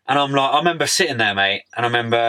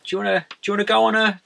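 quick, high, eager offer from a mate; pitched up, lightly excited; eager invitation; 10 semitones higher than usual. Retold or imitated. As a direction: pitch the road trip like a keen mate. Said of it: "he's like".

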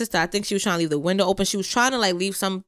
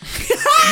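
high burst of laughter; 6 dB louder than usual. Real, not acted. Laugh before dad's quote.

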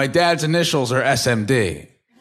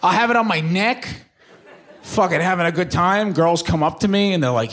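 normal talking voice

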